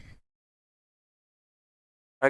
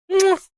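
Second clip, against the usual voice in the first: high smacking kiss sound; loud kiss; 5 dB louder than usual. Real, not acted. Owner kissing his Chihuahua, loud high smack. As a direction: plant a big loud kiss.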